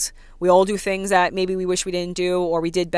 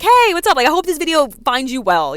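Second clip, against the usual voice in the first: bubbly greeting; 7 semitones higher, 5 dB louder than usual. Retold or imitated. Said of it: "And I'm like".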